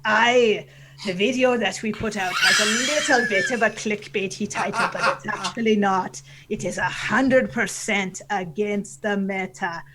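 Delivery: hammed-up Canadian pirate voice. Answering a listener's team question, Canadian pirate voice. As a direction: explain it like a comic pirate captain. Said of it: "your Canadian pirate voice".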